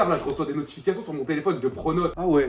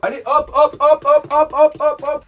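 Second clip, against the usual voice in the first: loud, higher hop hop hop; shouting hurry-up; 9 semitones higher, 8 dB louder, slower than usual. Acted. Brother shouts at sister to hurry, loud hop hop. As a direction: chase her down the stairs with it.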